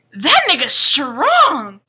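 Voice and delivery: loud, slow, drawn-out marvelling; mock amazement. Girl researching the vampire at home, slow loud mock amazement. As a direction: stretch it out high as the big climax. Mock awe, climax of a list.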